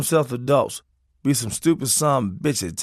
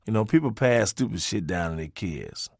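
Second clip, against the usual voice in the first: usual speaking voice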